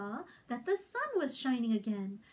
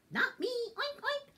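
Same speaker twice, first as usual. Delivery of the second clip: pig voice; 8 semitones higher than usual. Acted.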